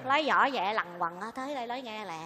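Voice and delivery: hushed, low-pitched confiding voice; speaking low. Acted. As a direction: drop right down so others won't hear. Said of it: "Bà nói nhỏ nhỏ, đừng nói lớn nha".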